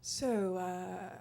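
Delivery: a hint of a leering man asking; mock dumb guy. Men in the room asking about the teeth, low and understated. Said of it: "he's had the same response".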